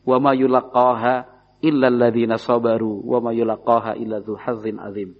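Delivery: measured melodic Quran chant; Quran recitation. Acted. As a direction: recite it like tilawah, drawing out the vowels.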